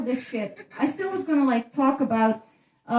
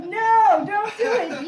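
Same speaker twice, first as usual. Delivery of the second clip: mock outcry in a raised voice; protesting crowd; 8 semitones higher, slower than usual. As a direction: imitate an alarmed audience pleading, pitched well up. Imitating the audience protesting.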